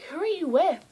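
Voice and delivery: high, loud retold question; eager question. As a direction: mimic an excited, swooping question.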